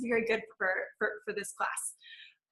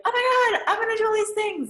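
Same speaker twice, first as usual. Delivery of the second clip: much higher, gushing oh my god; mock excitement; 11 semitones higher, 3 dB louder than usual. Someone telling friends their big plans, gushing much higher. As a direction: gush like you can't wait. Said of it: "you're like".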